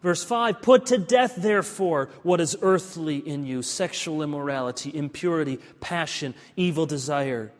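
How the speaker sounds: loud, ringing Bible reading; forceful scripture reading. Acted. Pastor reads scripture aloud with force. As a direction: proclaim the verses to the congregation.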